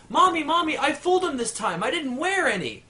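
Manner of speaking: put-on girl boasting to mom she fooled Johnny; triumphant little girl. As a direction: crow it like a proud little girl.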